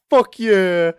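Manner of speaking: high, loud eager yell; excited shout. Retold or imitated. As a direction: shout it like the keenest guy in the room. Manager eagerly accepts chocolates.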